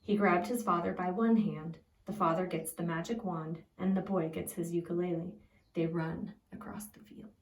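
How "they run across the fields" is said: hushed, breathy narration of the run; soft storyteller whisper. A narrator's reading. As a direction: whisper it like a secret chase.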